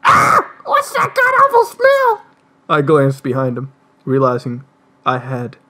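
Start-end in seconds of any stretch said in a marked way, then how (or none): 0.0-2.2 high, slow, disgusted shriek. Acted. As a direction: recoil from the stench, squealing. Character reacting to a stench.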